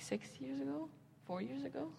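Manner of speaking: half-swallowed questioning mutter; muttered aside. Real, not acted. Unsure muttered aside to herself.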